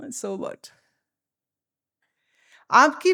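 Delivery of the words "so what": very soft, offhand dismissal; indifferent shrug. Retold or imitated. Teacher repeating the American children's mantra, softly indifferent. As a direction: shrug it off under your voice. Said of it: "they shrug their shoulders".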